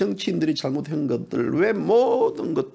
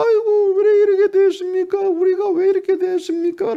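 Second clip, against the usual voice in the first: wailing, high, loud mock lament; 높고 크게 우는 소리로 한탄하는 지지자 흉내; 13 semitones higher, 4 dB louder than usual. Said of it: "라고 막 울". (울먹이며 높게 통곡하듯 "왜 이렇게 됐습니까"를 외치는 흉내)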